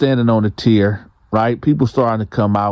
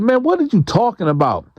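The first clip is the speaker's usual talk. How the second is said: indignant denial; 9 semitones higher than usual. Retold or imitated.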